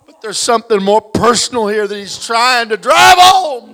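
loud rising preacher's shout; preaching shout. Real, not acted. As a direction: belt the point home to the congregation. Preacher emphasising a point.

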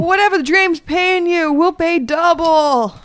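high, slow negotiating voice; bold bargain offer. Acted. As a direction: draw out the offer boldly. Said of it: "I say".

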